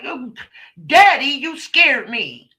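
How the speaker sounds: put-on high, startled little girl voice; child's scared voice. Father retelling his daughter startled by his grab, high and loud. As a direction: gasp it out like a frightened small child. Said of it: "she flinched, like".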